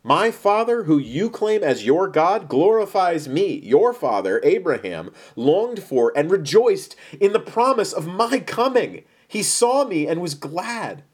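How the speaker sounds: slow, weighty declaration, pitch often lifted; Jesus, emphatic and earnest. Acted. Jesus answers the scoffers about his Father, emphatic. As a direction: declare it firmly, drawing out each phrase.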